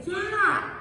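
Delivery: "yeah" high and loud, falling. Shown as a demonstration. Teacher mimicking the Lao teacher: high "yeah". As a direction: pitch "yeah" high then drop. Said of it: "like that".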